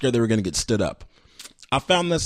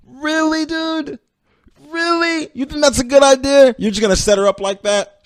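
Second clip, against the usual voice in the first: much higher, mocking really dude; incredulous; 12 semitones higher, 4 dB louder than usual. Real, not acted. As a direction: scoff at the plan in disbelief, pitched way up. Host mocks boyfriend's plan.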